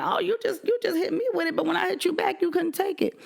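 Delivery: high, sing-song mocking voice; mocking taunt. Wife taunting her husband who could dish it but not take it, in a high mocking voice.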